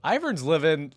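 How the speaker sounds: ordinary talk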